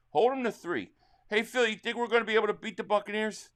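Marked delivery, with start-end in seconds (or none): none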